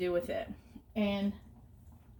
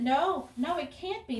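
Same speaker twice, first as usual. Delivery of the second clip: higher-pitched mock protest; imitated disbelief; 7 semitones higher than usual. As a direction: act out dad refusing to believe it. Retold dad refuses the explanation.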